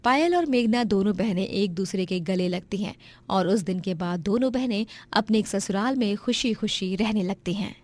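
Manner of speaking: plain reading voice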